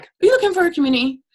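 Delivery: high, loud, fast question; high quick eager question; bright pitch. Shown as a demonstration. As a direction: sell it warmly and quickly. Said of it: "But, like".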